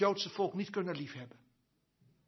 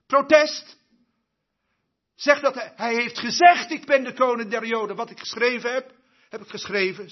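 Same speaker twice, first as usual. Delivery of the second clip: indignant protest; 3 semitones higher, 7 dB louder, faster than usual. Retold or imitated. Retold protest and Pilate's reply.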